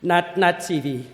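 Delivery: usual speaking voice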